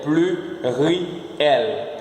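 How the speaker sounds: "Pluriel" slowly split as plu-ri-el; syllable-by-syllable demonstration. Shown as a demonstration. Teacher has learners repeat the French, slowly syllable by syllable. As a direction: draw out each syllable of "Pluriel". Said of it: "Você repete comigo".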